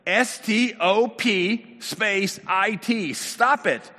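slow, high, loud letter-by-letter order; emphatic spelling out. Retold or imitated. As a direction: spell it out forcefully for someone not getting it. Therapist spells out his order.

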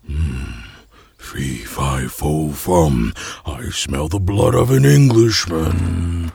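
deep rumbling giant chant; menacing giant voice. Acted. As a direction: growl it like a hungry giant. Giant sniffing out Jack.

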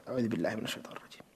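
a fast, breathy whisper under the breath; whispered ta'awwudh. Real, not acted. Teacher regains his thoughts, whispering the prayer quickly. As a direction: murmur the refuge prayer fast and unvoiced. Whispered refuge before resuming.

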